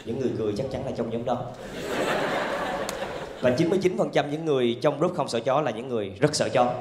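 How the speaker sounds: normal talking voice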